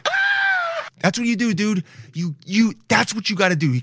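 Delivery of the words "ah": very high piercing mock laugh cry; high falsetto ahh; Kenneth Copeland shriek. Shown as a demonstration. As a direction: squeal it out like a preacher gone wild. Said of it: "and then you go".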